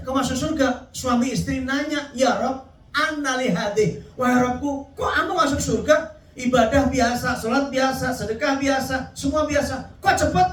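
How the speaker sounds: preacher voicing a couple baffled at entering heaven, high and amazed; amazed believer. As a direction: squeal it like someone who can't believe they got in. Voices puzzled couple in heaven.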